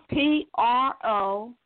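slow corrective spelling; spelling correction. Real, not acted.

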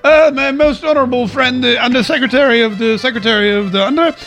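put-on British Parliament accent. Mocking British MPs in Parliament, hammed-up pompous British accent. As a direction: ham up a posh British parliamentarian. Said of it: "I love watching British people in Parliament 'cause they're all like".

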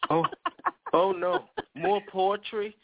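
ordinary delivery

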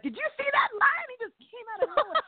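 high, wide-eyed squeal of a quote; playful high-pitched astonishment; excited mock disbelief. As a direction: act out spotting a lion, thrilled. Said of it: "I'm like".

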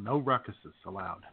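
usual speaking voice

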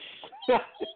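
squeaky high cricket chirp noise; a bad try at a cricket chirp; poor cricket imitation. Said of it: "what a poor imitation".

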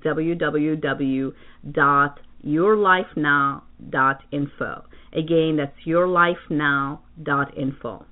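slow and careful, each part clear; deliberate announcer pace; slow spelled-out address. Real, not acted. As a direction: spell the web address out slowly for listeners.

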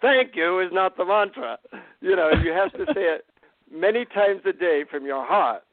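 put-on gently insisting guru in a light Indian accent. Guru telling him to mean it, light Indian accent. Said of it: "in his Indian accent".

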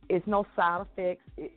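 usual speaking voice

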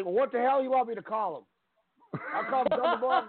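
high, gruff announcer voice, exasperated; Jim Ross impression. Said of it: "Did you hear Jim Ross".

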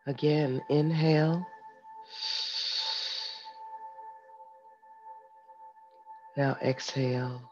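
soft, calm breathing guide; guided meditation. Acted. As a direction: lead them gently through each breath. Leads a breathing exercise.

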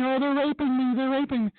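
high mock shriek, hammed up; mocking a protester screaming in the restroom. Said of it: "starts screaming the same thing".

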